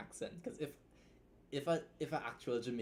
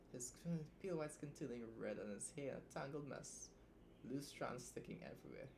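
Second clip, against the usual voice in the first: soft, quiet reading aloud; story reading; 6 dB quieter than usual. Acted. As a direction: read the passage softly.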